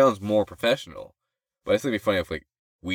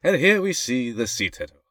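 higher-pitched British documentary narrator; nature narrator voice; 5 semitones higher than usual. Acted. Narrating Earth for a joke, grand narrator voice. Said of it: "that narrator voice".

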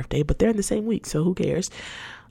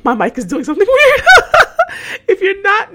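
giggling through the words; laughing; 10 dB louder than usual. Real, not acted. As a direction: laugh it off, voice high. Laughing at mic glitch.

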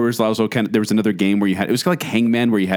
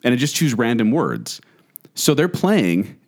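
ordinary delivery

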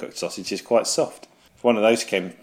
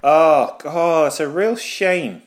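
mock sympathy; 6 semitones higher, 4 dB louder, slower than usual. Mock sympathy for failed friend.

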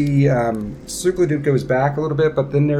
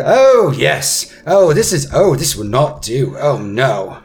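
fussy prince, disgusted; 3 semitones higher, 6 dB louder, slower than usual. Acted. Prince Darren finds the fish town beneath him, theatrical disgust. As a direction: recoil with haughty dismay.

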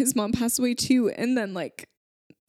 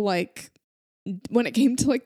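ordinary talk

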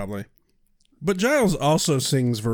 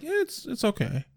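1 unenthused quote; 9 semitones higher, 6 dB quieter than usual. Retold or imitated. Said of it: "his response is like".

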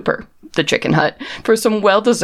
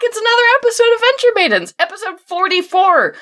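excited announcer. Real, not acted. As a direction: pump up the crowd. Excited livestream opening.

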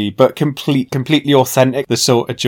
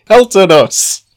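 higher, louder, slow mock triumph; excited; 9 semitones higher, 9 dB louder than usual. Real, not acted. Hosts congratulating themselves on five seasons, loud and proud. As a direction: cheer yourselves on proudly.

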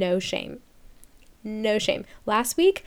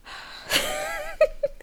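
high breathless laugh; 3 dB quieter than usual. Real, not acted. Laughing at own rant.

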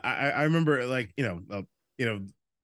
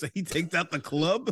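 ordinary delivery

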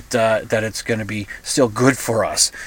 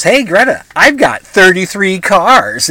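loud, slow, higher bragging jeer; mocking taunt; 5 semitones higher, 5 dB louder, slower than usual. Tate taunts Greta about his cars, loud and slow. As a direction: rub it in her face.

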